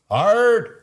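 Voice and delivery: hard, punched high and loud; a sudden loud push on hard; emphatic hard. Real, not acted. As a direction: hit it hard to rally viewers. Pumping up for live trade.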